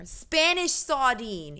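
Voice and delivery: muddled accent between Australian and Irish. Shown as a demonstration. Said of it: "straight into either Australian or Irish".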